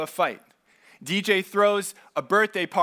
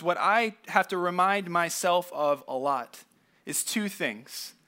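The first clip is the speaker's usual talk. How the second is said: ordinary delivery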